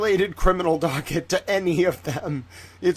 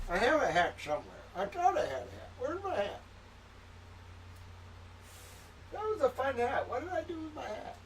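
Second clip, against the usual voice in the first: distant, high and soft; off-mic searching; 8 semitones higher, 13 dB quieter than usual. Real, not acted. Host hunting for a misplaced hat mid-stream, distant and high-voiced. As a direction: call it out softly while hunting around.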